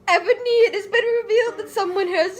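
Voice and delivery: high, fast, giggly reading; laughing. Real, not acted. Reader cracking up while voicing Dumbledore, high.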